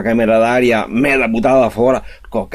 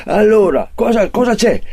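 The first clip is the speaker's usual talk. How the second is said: surprised pharmacist; 8 semitones higher than usual. Acted. Pharmacist reacts.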